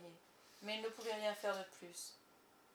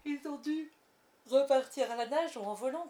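lightly voiced boys, higher and louder; boys' voices; 5 semitones higher, 8 dB louder than usual. A narrator's reading. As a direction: play the carefree lost boys. Les garçons supposent, voix d'enfants. (voix légère de garçons insouciants)

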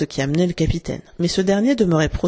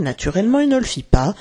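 ordinary delivery

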